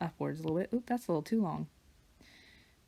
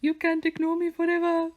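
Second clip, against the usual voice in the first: much higher, louder teasing squeak; high-pitched pet voice; 11 semitones higher, 7 dB louder than usual. Retold or imitated. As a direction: playfully scold the cat in a squeaky voice. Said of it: "I had a high-pitched voice for her".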